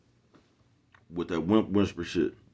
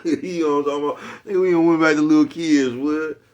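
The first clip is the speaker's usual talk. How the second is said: laughing; 7 semitones higher, 7 dB louder than usual. Real, not acted.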